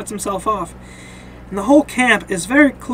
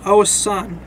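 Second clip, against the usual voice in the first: ordinary talk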